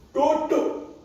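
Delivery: loud, high, sharp order; angry command. Acted. Grandfather makes grandchild sit, angry command. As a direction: order the child down forcefully. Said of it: "Darahku mulai mendidih".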